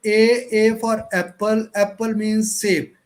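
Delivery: slow, deliberate reading aloud, each letter separate; loud and slow. Shown as a demonstration. Teacher demoing for students a slow, loud alphabet drill. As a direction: read it out slowly and a bit loud like a drill. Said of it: "थोड़ा ऊंचा बोलकर".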